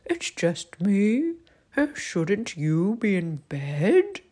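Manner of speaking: slow, loud owl character; drawn-out words like an owl. Acted. Owl asking Albie about bed, slow loud owl voice.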